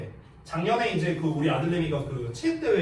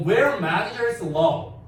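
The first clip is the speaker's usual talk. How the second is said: slow English reading; 3 semitones higher, 5 dB louder, much slower than usual. Shown as a demonstration. Reading the English passage aloud.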